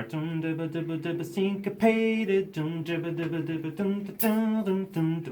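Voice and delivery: syncopated guitar imitation. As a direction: scat a syncopated guitar riff. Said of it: "the guitar was a little more".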